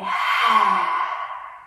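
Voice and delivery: long open exhale with tongue out; lion's breath sigh. Shown as a demonstration. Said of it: "stick out your tongue and let out a big sigh".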